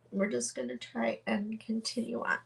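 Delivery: soft, teary laugh into the words; quiet tearful chuckle; laughing through tears. Real, not acted. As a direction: laugh off the crying and push on.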